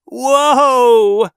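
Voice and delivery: loud, high scream of terror; terrified horror victim. Acted. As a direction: scream as the car spins on ice. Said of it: "He screamed in terror".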